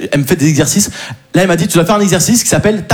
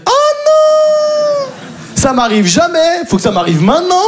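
very high oh non, slowing down; squealing panic; 11 semitones higher, slower than usual. Retold or imitated. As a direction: dread at being recognised. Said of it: "je fais". (cri aigu de panique)

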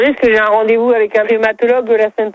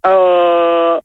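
a lower, deeper ahh; 4 semitones lower than usual. Real, not acted. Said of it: "Maintenant, un A plus grave".

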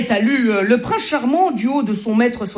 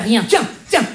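ordinary talk

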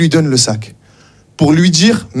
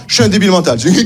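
ordinary talk

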